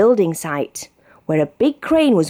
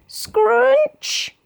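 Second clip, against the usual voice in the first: high scrunch; crunch sound word. A narrator's reading. Narrator sounding the mudguard crumpling, high.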